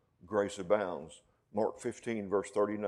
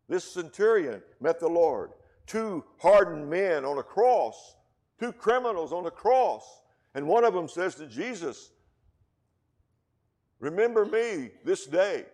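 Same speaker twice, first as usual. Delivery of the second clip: loud, drawn-out pulpit voice; preaching at full force; 8 semitones higher, 8 dB louder than usual. Acted. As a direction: paint the cross for the church. Preaching the two criminals.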